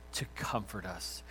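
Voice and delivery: normal talking voice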